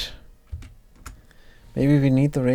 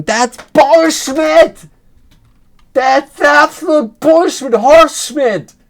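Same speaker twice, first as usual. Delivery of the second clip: screamed much higher and louder, dragged out slowly; furious shout; 11 dB louder, slower than usual. Acted. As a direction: yell it at the representative like an outraged citizen. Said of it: "you just shout in".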